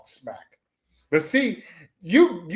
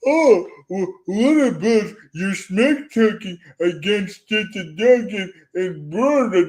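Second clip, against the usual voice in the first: louder mocking impersonation of an objector; mock objection; 2 semitones higher, 4 dB louder than usual. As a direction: parrot their complaint back in a loud, put-on voice. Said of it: "I know what you're saying".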